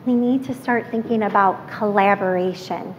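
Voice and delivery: normal talking voice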